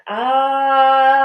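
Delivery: long, loud, open Ah sound. Shown as a demonstration. Last breath of the exercise, loud sustained Ah. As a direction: push out a big sustained Ah.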